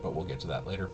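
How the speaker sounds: ordinary delivery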